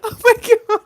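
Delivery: high, loud, helpless laughter through tears; crying with laughter. Real, not acted. Streamer cracking up at her own song parody, helpless tearful laughter. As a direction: laugh until you cry, can't stop. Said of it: "It's making me cry".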